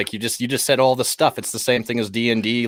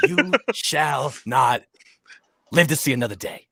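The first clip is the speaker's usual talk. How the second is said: mock Gandalf. Parodying Gandalf's famous line.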